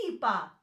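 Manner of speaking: ipa with a long, stressed opening "i"; stress on opening sound. Shown as a demonstration. Teacher showing stress on a double vowel, ipa stressed on its opening "i". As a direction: draw out the first sound of ipa. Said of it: "We put the stress in the second vowel".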